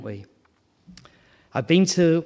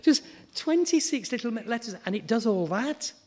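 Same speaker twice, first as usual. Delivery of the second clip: amazed; 8 semitones higher than usual. Real, not acted. Marvels at the alphabet.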